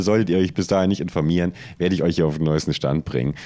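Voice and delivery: usual speaking voice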